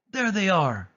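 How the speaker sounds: high spotting cry; villain crying out on spotting dogs. A narrator's reading. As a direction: point and shout them out. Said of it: "Cruella cried".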